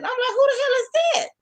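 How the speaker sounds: a high, incredulous who-the-hell; baffled high squeak. Retold or imitated. Recapping the show, stunned at the host's mistake. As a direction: shoot way up in pitch, stunned. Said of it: "I'm like".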